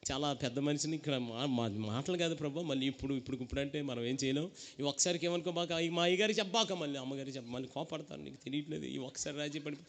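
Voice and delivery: low, soft wheedling plea; compromising old believer. Retold or imitated. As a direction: beg God to excuse this once, in a low voice. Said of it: "మళ్ళీ, మళ్ళీ వచ్చారు".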